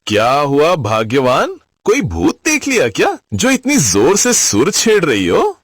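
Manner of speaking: mock-concerned husband teasing his wife; playful, needling question in a husband's voice; amused teasing. A narrator's reading. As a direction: poke fun with relish. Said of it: "Raghunath जी ने मजे लेते हुए पूछा".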